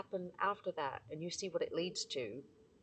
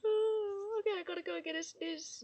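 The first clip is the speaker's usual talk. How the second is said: ordinary talk, nothing marked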